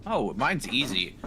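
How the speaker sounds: normal talking voice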